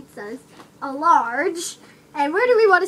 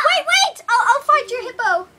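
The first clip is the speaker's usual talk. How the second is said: urgent shout; 8 semitones higher than usual. Pretend. Clerk stops caller hanging up.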